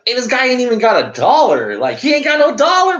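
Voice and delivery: high, loud jeering call; mock public heckling. Street beggar shaming a passer-by to others, mock shouted heckle. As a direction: call him out for everyone to hear. Homeless man mocks him to passers-by.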